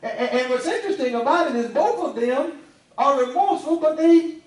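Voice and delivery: preacher laughing as he rises to a shout; excited shout. Real, not acted. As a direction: build it up until you're laughing and shouting.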